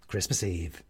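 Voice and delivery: usual speaking voice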